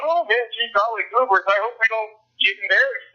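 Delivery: mock worrying. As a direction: put on a pretend aw-shucks fretting. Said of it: "pretend I'm gonna be going".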